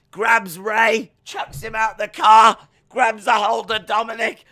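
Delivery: high, loud punchy action calls; shouted storytelling. Real, not acted. Pitching the car attack, shouted excited telling. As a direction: shout each move like it's happening.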